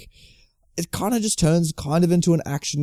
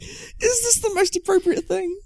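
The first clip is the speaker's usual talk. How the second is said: much higher, slow, incredulous mock question; slower than usual. Questioning the banjo music, high slow incredulous squeak. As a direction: squeak it in disbelief. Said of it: "And I'm like".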